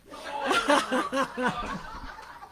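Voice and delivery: airy burst of laughter at her own story; breathy laugh. Real, not acted. Grandmother laughing at her daughter's joke, airy laugh. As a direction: laugh it off silently.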